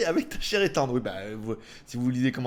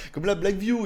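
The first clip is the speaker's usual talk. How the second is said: ordinary talk, nothing marked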